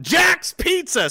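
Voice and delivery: high, drawn-out shout; excited shout. Real, not acted. Recognizing the pizza place someone named, excited shout. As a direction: bellow it slowly and high.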